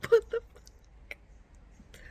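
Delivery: very high, slow, strangled wail; sobbing squeak. Real, not acted. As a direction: let it squeak out through sobs. Crying over book.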